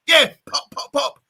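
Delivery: shouty football coach giving orders; bossy coach. Pretend. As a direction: bark it like a drill coach. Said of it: "And I'm like a coach".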